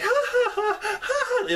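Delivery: very high, far-off mock laugh of a terrified girl; hysterical laugh. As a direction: laugh it out like a relieved, half-crazed escapee. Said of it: "she's basically laughing at the situation".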